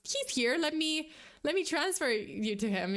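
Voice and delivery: jumps very high, then bubbles along eagerly; excited at first. Retold or imitated. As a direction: chirp it out like good news. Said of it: "she was at first excited".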